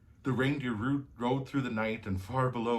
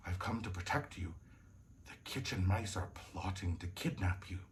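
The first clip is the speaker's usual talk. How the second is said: low, very soft confiding voice; quiet, urgent prince; hushed warning; 4 semitones lower, 8 dB quieter than usual. A narrator's reading. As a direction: warn in a secretive hush. Prince warns of secret plot.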